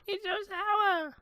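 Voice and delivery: high, slow whining; whiny mock baby. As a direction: whimper it like a crybaby. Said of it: "That's what you sounded like".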